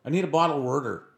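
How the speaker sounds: high Philly voice, "water" as "wurder"; Philadelphia accent. Retold or imitated. Guy asking for bottled water, Philadelphia accent. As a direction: play a Philly guy hunting for the word. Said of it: "in Philadelphia, and he's like".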